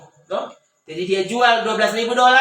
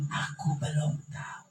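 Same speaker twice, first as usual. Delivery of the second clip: humble near-whisper; 5 semitones lower, 10 dB quieter, slower than usual. Retold or imitated. Pastor admits humbly.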